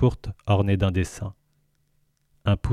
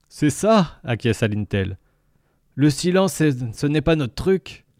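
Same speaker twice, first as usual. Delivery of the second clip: gurgling tentacle alien; 6 semitones higher than usual. Acted. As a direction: speak as the jovial alien. Lintel voice.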